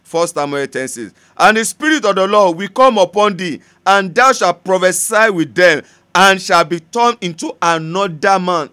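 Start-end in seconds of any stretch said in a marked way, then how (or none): 1.4-8.7 loud, slow, ringing scripture reading; preacher thundering the verse; forceful preaching. Acted. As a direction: proclaim it to the congregation.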